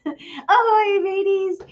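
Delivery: mock pirate hello; playful pirate greeting. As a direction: hail the group like a jolly sailor.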